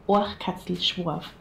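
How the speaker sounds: Oachkatzlschworf said slowly as OAKH-katsl-shvorf; Bavarian pronunciation. Shown as a demonstration. Bavarian showing the word for squirrel tail, native Oachkatzlschworf pronunciation. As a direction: model Oachkatzlschworf with throaty ch and a clear shv. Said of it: "it means the squirrel tail".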